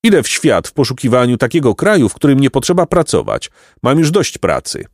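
pompous donkey philosopher. A narrator's reading. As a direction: declaim grievances as a misunderstood scholar.

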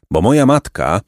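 ordinary delivery, nothing marked

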